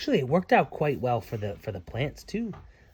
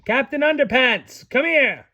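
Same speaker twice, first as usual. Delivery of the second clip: calling out; 9 semitones higher, 7 dB louder than usual. Real, not acted. Calling the boy over.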